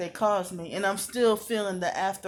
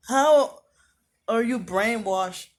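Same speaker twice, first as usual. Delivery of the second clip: loud indignant how; 3 semitones higher, 6 dB louder, slower than usual. Real, not acted. Indignant outburst at accusers.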